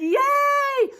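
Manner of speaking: very high, loud hurrah; cheer. Acted. Crowd celebrating, loud high cheer. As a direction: whoop for joy. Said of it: "the crowd cheered".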